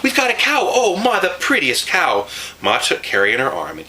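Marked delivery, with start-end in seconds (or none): 0.0-2.3 excited yelling. A narrator's reading. Girl rushing in to tell Ma about the cow, yelling. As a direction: burst in shouting the news.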